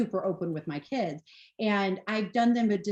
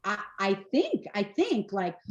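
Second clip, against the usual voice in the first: usual speaking voice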